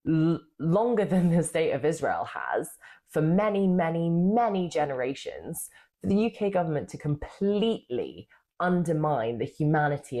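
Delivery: crisp, clipped southern English delivery; the earlier cut-glass English speech; posh English speech in Parliament; posh English accent. Real, not acted. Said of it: "her mode of posh English girl".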